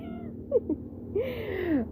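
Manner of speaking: a burst of high-pitched laughter. Real, not acted. Vlogger laughing at her own pleading to the fishmonger, high giggle.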